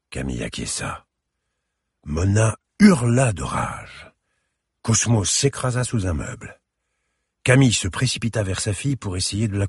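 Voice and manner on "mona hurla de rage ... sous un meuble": ordinary delivery, nothing marked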